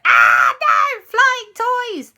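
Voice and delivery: loud, mock-frightened cry; panicked shout. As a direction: yell as toys fly at you. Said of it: "Everyone was like".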